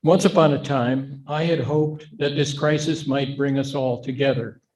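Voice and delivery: ordinary talk, nothing marked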